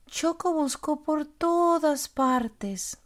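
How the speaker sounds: usual speaking voice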